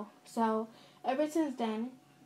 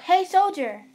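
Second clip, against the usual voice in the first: loud, high summoning call; raised, higher-pitched shout; yelled out; 7 semitones higher, 12 dB louder, slower than usual. A narrator's reading. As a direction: yell for your little brother like an officer. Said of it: "You yelled out".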